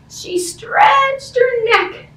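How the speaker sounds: high and very slow, stretching upward; stretched out high. A narrator's reading. Teacher reads the kitten stretching up, slow and high. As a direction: draw the words out high like a stretch.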